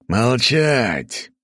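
loud, growled order to be silent; roaring command. Acted. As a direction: roar it like an angry king. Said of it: "рыкнул король".

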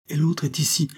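high-pitched, emphatic declaration; vehement. A narrator's reading. Girl claiming Marianne as her mother, vehement. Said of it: "reprit-elle avec véhémence".

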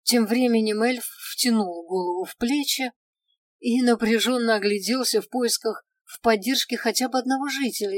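ordinary delivery

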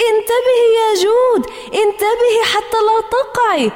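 very high, alarmed warning shout; panicked cry. A narrator's reading. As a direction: scream a warning to a friend. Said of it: "صاحت هبة بفزع".

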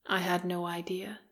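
unvoiced whisper. A narrator's reading. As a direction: breathe it almost silently. Whispered confession.

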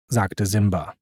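ordinary delivery, nothing marked

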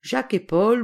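usual speaking voice

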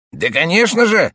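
high, loud eager uncle. A narrator's reading. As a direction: burst in as the pushy uncle. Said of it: "отвечал дядя".

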